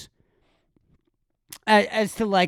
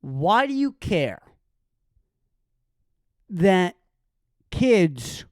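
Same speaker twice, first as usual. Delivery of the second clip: mocking the excuse maker; 5 semitones lower, 4 dB quieter than usual.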